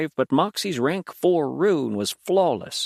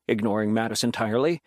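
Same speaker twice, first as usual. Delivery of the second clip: ordinary delivery, nothing marked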